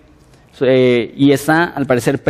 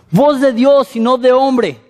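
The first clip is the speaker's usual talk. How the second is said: higher, flattering crowd cry; mock acclaim; 8 semitones higher than usual. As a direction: hail the king like a fawning crowd. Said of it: "¿y qué es lo que dicen?". (aclamación burlona de la multitud)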